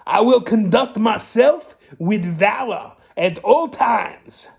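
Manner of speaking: a rascal assures the captain of his courage, primly. Acted. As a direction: answer as a polite, proper rascal. Rascal answers Pete.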